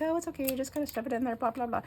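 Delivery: lightly voiced high breezy girl, brushing it off; mock girl coworker. The laughing coworker telling the stocker to just stuff it in, mock high breezy voice. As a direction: chirp it off like it's no big deal.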